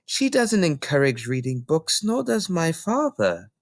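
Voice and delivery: put-on a small girl answering the librarian; Matilda, young girl. Little Matilda explaining to Mrs Phelps, young girl voice.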